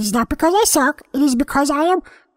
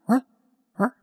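quick, puzzled little whats; wary cat checking a noise; alert what; 2 semitones lower, 4 dB quieter than usual. Shown as a demonstration. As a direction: perk up and ask what. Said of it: "Like that".

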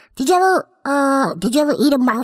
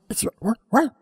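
quick, puzzled little whats; wary cat checking a noise; alert what; 2 semitones lower, 4 dB quieter than usual. Shown as a demonstration. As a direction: perk up and ask what. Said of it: "Like that".

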